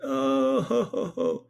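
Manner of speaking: high-pitched mock bawling; hammed-up sobbing wail; imitated crying. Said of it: "this dude starts crying".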